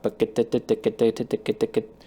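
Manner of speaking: "get" chanted with shifting accents on the beat; rhythmic accented syllables. Shown as a demonstration. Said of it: "putting, like, sort of accents in different spots".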